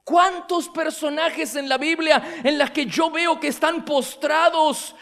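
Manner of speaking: loud, impassioned pulpit voice, building; fervent preaching. Acted. Preacher urging his congregation, loud and impassioned. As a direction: stir the congregation with rising passion.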